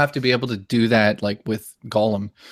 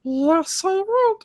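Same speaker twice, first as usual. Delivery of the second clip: very high cartoon little-boy voice; Bobby; much slower than usual. Shown as a demonstration. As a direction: squeak it like a cartoon kid. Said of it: "I can do Bobby".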